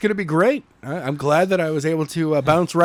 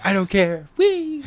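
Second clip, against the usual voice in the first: carefree whoop; 6 semitones higher than usual. Retold or imitated. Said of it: "And like".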